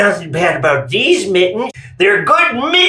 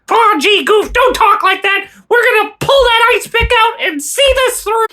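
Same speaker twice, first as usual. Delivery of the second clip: high squeaky cartoon mouse; Mickey Mouse voice; 13 semitones higher than usual. Mickey Mouse cheering up Goofy about the ice pick, high and squeaky. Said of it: "I'm Mickey Mouse".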